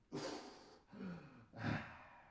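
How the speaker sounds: soft, long falling exhale; long sigh. Real, not acted. Dad recovering after the forward fold with a sigh. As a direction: breathe out the effort. Catching breath after pose.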